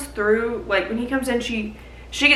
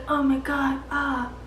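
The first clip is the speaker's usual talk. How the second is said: stunned awe; 4 semitones higher, 7 dB quieter than usual. Retold or imitated. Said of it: "Kale's like".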